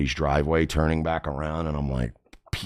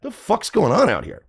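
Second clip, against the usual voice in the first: high-pitched, alarmed challenge; imitated angry demand; 11 semitones higher, 3 dB louder than usual. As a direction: act out storming into a quarrel. Friend comes out to the quarrel.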